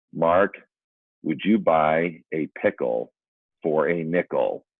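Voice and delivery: slow, measured retold advice; deliberate delivery. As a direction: space out each phrase like magic words. Solemnly delivers the promised magic words.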